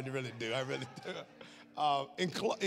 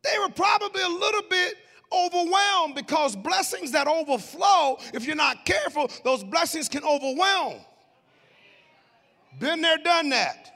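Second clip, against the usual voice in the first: high, loud preaching build; full-force preaching; 8 semitones higher, 4 dB louder than usual. Real, not acted. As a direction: preach it out to the congregation.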